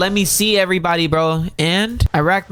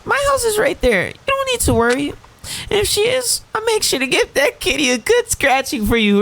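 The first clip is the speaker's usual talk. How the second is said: high-pitched put-on character voice; light falsetto dialogue voice; game character's friend; 11 semitones higher than usual. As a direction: play the friend much higher than yourself.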